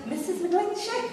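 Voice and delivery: put-on posh, pitched well up; posh phone voice. Receptionist addressing the caller by name, high and posh. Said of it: "I decided I'd be rather posh".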